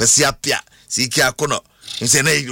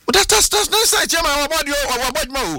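excited; 7 semitones higher than usual. Real, not acted. Excited host on trophy count.

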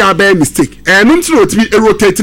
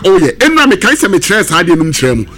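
ordinary talk

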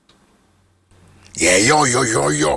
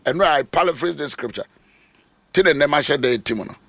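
normal talking voice

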